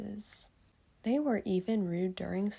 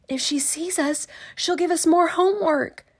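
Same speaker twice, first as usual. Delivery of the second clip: loud, much higher alarmed voice; panicked kids; 10 semitones higher, 10 dB louder than usual. Acted. Kids spotting Miss Swamp on the street, loud high panic. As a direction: gasp it out in a panic.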